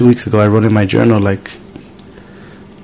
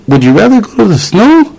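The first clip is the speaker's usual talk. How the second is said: sweet parent ask; 10 semitones higher than usual. Retold or imitated. Asking daughter sweetly.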